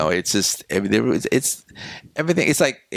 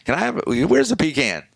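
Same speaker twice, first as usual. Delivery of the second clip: mother asking; 9 semitones higher than usual. Retold or imitated. Mother asks clerk for pecans.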